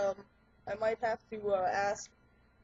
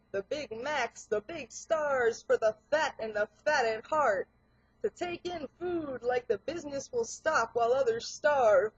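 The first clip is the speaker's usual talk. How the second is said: punchy rhymed verse, stressed on the beat; rhythmic spoken-word poem; 2 semitones higher, 4 dB louder than usual. Acted. Performer reciting a satire on fat America, rhythmic spoken word. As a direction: recite it like rap without music.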